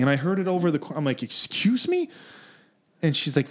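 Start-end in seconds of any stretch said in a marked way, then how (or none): 1.2-2.1 very high, incredulous question; affronted disbelief. Retold or imitated. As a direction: act out being taken aback, voice shooting up. Repeats his offended reply in story.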